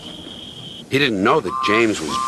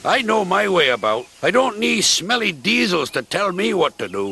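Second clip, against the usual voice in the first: higher, blunt grumpy engine voice; gruff Duncan; 8 semitones higher than usual. Acted. Duncan speaks.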